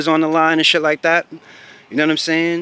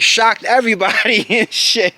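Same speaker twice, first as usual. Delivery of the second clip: normal talking voice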